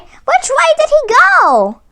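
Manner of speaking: high, loud, urgent and slow; crying out. A narrator's reading. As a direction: demand it in a frantic cry. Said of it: "he cried".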